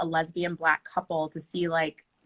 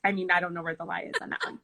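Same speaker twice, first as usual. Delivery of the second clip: usual speaking voice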